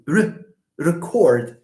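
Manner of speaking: slow "record" with a short, weak "re"; barely touch the "re" of "record", then stretch "cord"; light first syllable. Shown as a demonstration. Teacher shows the light syllable, reduced "re" before strong "cord" in "record". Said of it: "re is pronounced in a light way".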